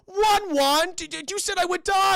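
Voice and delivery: high, loud whining wail; high loud mock crying complaint; whiny Tulip. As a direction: wail it like a betrayed kid. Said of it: "Tulip was like".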